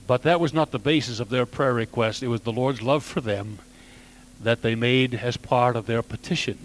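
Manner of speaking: ordinary talk, nothing marked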